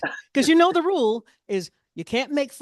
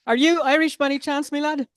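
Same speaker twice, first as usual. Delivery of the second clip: higher-pitched mock Irish accent; playful stage-Irish accent, pitched up; bad Irish accent; 5 semitones higher than usual. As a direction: put on a bad Irish brogue. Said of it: "very bad and possibly offensive Irish accent".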